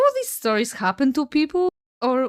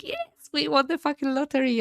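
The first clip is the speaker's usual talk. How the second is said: mildly hammed-up jubilant cheer, higher pitched; lightly acted celebration, pitch lifted; excited; 6 semitones higher than usual. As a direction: retell it like a lottery win. Retold joy at free dishwasher.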